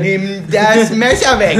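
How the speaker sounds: loud, slow mock alarm; mock shout. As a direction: yell it in fake panic. Playful shout at friend. (lautes gespieltes Entsetzen)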